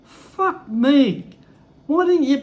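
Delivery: ordinary talk, nothing marked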